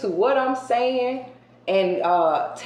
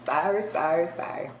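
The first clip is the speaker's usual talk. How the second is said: laughing apology; 2 semitones lower, 6 dB quieter than usual. Real, not acted.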